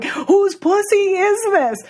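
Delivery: high-pitched imitation of a man's yell; mock screaming. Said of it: "because he was screaming this".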